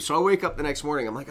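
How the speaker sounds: ordinary talk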